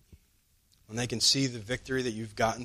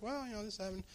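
light, higher cheerful telling; cheerfully; 9 semitones higher, 13 dB quieter, faster than usual. Retold or imitated. Telling the coworker about troubles, mock cheerful. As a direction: shrug it off brightly, mock breezy.

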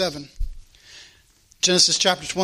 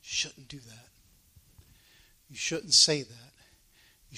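hushed, lower inner voice; still small voice; 5 semitones lower, 9 dB quieter than usual. Acted. The Holy Spirit cautioning someone about to do wrong, still small voice. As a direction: speak softly, like a quiet prompting within. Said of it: "the still small voice in there".

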